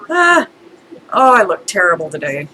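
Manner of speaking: normal talking voice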